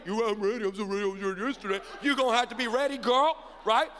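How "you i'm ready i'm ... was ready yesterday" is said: rapid mocking parrot of the daughter; mimicked boast. Dad throwing daughter's boast back at her, rapid mimicry. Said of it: "if you told me you're ready".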